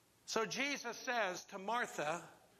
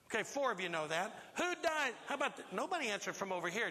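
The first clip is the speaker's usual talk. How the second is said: ordinary talk, nothing marked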